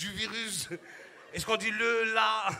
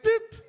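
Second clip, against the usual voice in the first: high beep; 12 semitones higher, 8 dB louder than usual. Retold or imitated. Thermometer beep imitated.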